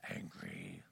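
breathy whispered word; whispered angry. Real, not acted. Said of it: "I'm not a person to get".

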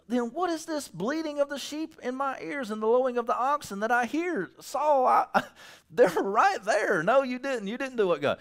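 high, lightly voiced Samuel, exasperated; voicing Samuel. Samuel confronts Saul about the sheep, exasperated. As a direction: read it as a fed-up prophet. Samuel's scripture quote, acted.

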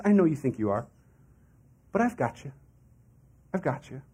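tender reassurance. Acted. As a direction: hold them close with it. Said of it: "He says".

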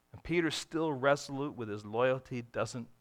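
ordinary talk, nothing marked

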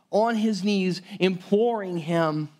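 ordinary talk, nothing marked

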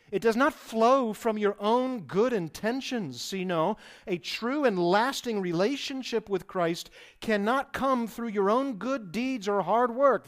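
loud, rising sermon build; preaching at full force. Acted. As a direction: drive the warning home to the congregation. Preaching climax on self-reliance.

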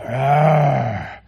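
long drawn-out playful "arr"; imitated child's monster growl; kid's monster roar. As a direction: roar like a kid playing monster. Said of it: "they're going like this".

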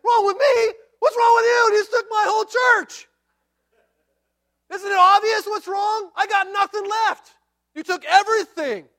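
high, shouted indignation; hammed-up yell of a man robbed of everything; outraged Micah. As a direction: explode in incredulous protest at full volume. Micah's outraged answer, acted out.

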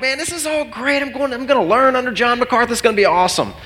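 high, eager anticipation; excited. Retold or imitated. Pastor anticipating seminary, high excited.

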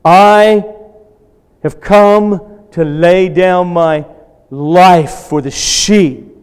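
loud, high, slow preaching build; forceful preaching. Acted. Pastor driving home God's sovereignty to the congregation, loud preaching. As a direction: proclaim God's control with rising force.

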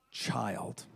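put-on near-whispered, very soft CHILD; hushed word. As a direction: let the word fall gently, reverent. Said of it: "felt like a word was spoken".

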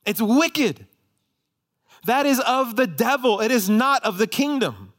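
high, loud pulpit condemnation; forceful denunciation. Real, not acted. Preacher condemning withheld forgiveness as of the devil, forceful denunciation. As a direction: denounce it at full force.

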